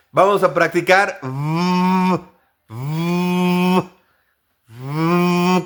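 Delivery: long voiced "v-" hum; buzzing v. Shown as a demonstration. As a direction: buzz "v-" like a vibrating phone. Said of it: "como el sonido del celular cuando vibra".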